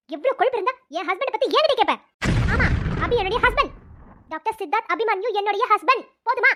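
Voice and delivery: loud, forceful possessive anger; angry outburst. Acted. Wife claims her husband before the girl, angry. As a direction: hammer it home furiously.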